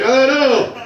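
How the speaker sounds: high panicked "Oh no!"; panicked yelp. Retold or imitated. Comic telling his panic, high startled yelp. Panicked reaction to approaching woman.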